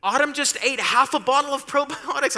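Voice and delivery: high, alarmed call to her husband; alarmed wife. Retold or imitated. Wife phones husband at work about daughter, panicked and high. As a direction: blurt the news in a high panic. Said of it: "She's like".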